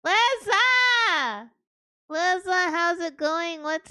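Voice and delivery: high, bright call of a name; excited greeting. Real, not acted. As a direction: greet her with sudden delight.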